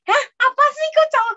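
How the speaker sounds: high, loud baffled "hah"; bewildered stranger reacting; puzzled local. Playing a confused Chinese listener, high and loud.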